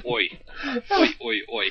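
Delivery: a hint of Aussie bloke calling "Oi, oi, oi"; mock Australian "oi". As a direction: toss off a jokey Aussie "oi". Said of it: "I just want to say".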